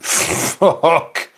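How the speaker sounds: high, shocked mock curse; dismayed realization. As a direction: blurt it in comic dismay. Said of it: "you're like".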